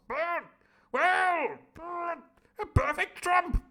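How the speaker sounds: very high loud slow pompous declaration; the Squire declaring. Acted. The Squire praising Long John, high and booming. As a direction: proclaim it like a booming toff. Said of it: "will you stop doing those perfect trumps".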